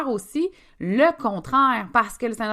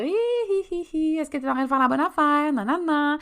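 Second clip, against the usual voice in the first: high, mocking little pest voice; taunting impostor syndrome; 8 semitones higher, 3 dB louder than usual. Impostor syndrome popping up to taunt her, high and mocking. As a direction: tease in a high sing-song. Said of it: "Il va venir nous narguer".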